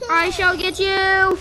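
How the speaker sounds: high, slow, grand menace; hammed-up threat; mock villain. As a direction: draw it out like a cartoon villain.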